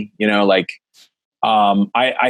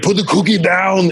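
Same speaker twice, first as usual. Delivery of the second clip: higher, firm movie-star line; Arnold quote; 7 semitones higher than usual. Retold or imitated. Dad quoting Jingle All the Way, Arnold voice. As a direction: deliver it like the action star. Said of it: "and Arnold, like".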